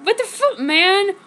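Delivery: high, loud angry outburst. Acted. Ward swearing in anger at the betrayal, high and loud. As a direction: blurt it out furious. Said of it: "Ward said angrily".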